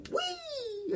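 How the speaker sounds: very high quiet "wee" squeal; mock joyride "wee"; gleeful squeal. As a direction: squeal like on a thrill ride. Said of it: "He was like".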